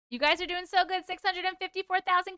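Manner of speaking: ordinary delivery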